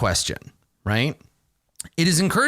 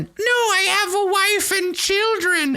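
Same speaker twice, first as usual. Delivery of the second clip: high, slow squeak of a begging kobold; squeaky kobold. Acted. Kobold pleading for its life to the adventurer, high squeak. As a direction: squeak it out like a tiny cornered creature. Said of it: "the kobold suddenly squeaks out in common".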